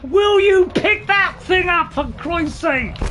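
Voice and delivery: slow, high, insistent demand; exasperated shout. Real, not acted. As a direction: spell out the order in loud frustration. Player scolding game character.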